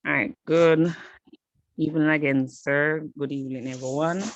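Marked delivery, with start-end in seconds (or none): none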